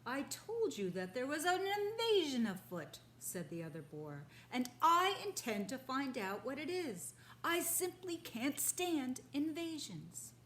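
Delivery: a blustering boar who hates invasions; indignant boar. Acted. Second boar vowing to find the invasion, indignant.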